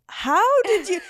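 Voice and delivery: high, incredulous mock retort; exasperated disbelief. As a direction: throw it back at him in disbelief. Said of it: "I'm like".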